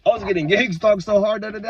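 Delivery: usual speaking voice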